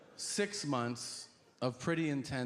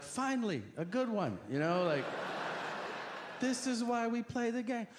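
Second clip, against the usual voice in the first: mock excited; 10 semitones higher than usual. Eager caller imagined.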